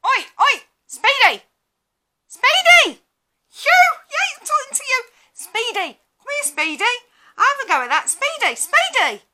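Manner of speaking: loud, high mock heckler's shout. Heckler shouting at her in the street, loud high mock yell. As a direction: bellow it across the road at her. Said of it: "you'll hear someone shout".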